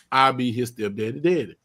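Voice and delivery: usual speaking voice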